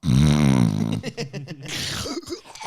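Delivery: loud, low mock snore; long rumbling snore put on for laughs; imitated snore. Showing friends how he conks out, loud mock snoring. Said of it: "As soon as I'm out, I'm".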